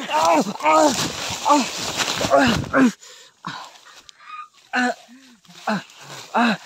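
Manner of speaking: loud high yelps as if struck; mock screams. Vlogger yelps in pretend terror as the aswang attacks him, loud and high. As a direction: cry out when the aswang hits.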